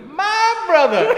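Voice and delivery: high, slow, loud call of "My brother"; hammed-up joyful hail; Ray's greeting. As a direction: belt it across the station like a long-lost friend. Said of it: "he goes".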